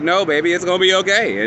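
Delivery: calm a terrified partner, but too eager; reassuring monster. Retold or imitated. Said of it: "and he like".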